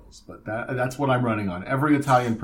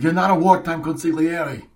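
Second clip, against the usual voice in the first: raised, higher mock scolding; acted mob outburst; 6 semitones higher, 6 dB louder than usual. Quoting a mob son dressing down the consigliere, loud and high. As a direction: fire it off loud as a film quote. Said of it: "he's the one who's like".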